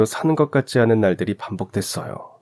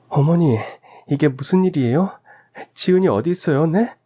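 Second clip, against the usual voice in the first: higher, urgent questioning; frantic daughter-in-law; 7 semitones higher than usual. Acted. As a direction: press her mother-in-law for answers. Wife confronts mother-in-law. (시어머니에게 다급히 묻는 며느리)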